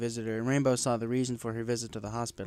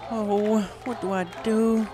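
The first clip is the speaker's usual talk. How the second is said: worried wondering; 10 semitones higher, slower than usual. A narrator's reading. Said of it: "wondered aloud".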